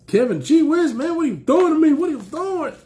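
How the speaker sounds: high, fast, thrilled protest; overwhelmed excitement. Real, not acted. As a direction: cry out in delighted disbelief at the gift. Overwhelmed by a friend's gift.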